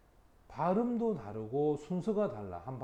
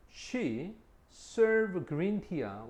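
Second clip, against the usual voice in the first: slow reading; 4 semitones higher, 4 dB louder, much slower than usual. Shown as a demonstration. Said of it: "slow example reading".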